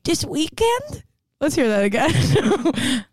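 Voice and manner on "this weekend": high, slow, drawn-out mocking whine; mock whiny boyfriend. Friend mocking the boyfriend's line, high drawn-out whine. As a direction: stretch it out high like a sulky boyfriend. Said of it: "Listen to his voice".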